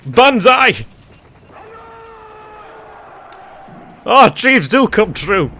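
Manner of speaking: very high, loud triumphant yells and laughter; ecstatic cheering. Real, not acted. Gamer celebrating a successful landing, ecstatic shouting. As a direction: cheer like your dream just came true. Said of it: "I'm feeling quite emotional now".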